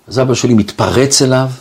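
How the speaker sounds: ordinary delivery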